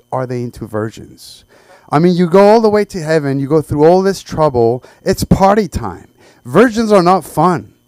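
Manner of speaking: ordinary talk, nothing marked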